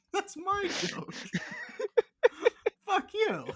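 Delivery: high, loud laughing protest; cracking up while yelling about the joke; laughing shout. Real, not acted. Laughing outrage over stolen joke.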